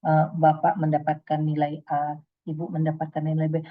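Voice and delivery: flat speech read in one tone throughout; monotone speech demo. Shown as a demonstration. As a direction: read "Selamat pagi Bapak-bapak" all on one dull note. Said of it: "Intonasinya sama semua".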